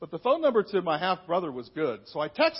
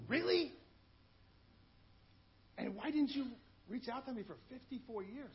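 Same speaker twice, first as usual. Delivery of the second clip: high, incredulous Really; a retold gasp of disbelief at the absent father; stunned disbelief; 6 semitones higher, 11 dB quieter than usual. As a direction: ask it in hurt disbelief, pitched up. Retold reaction to real father.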